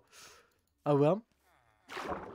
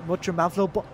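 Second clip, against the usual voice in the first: normal talking voice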